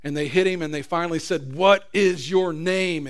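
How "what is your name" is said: slow demanding shout. Acted. Master demanding the name, slow and loud. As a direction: drag out the demand, loud and higher.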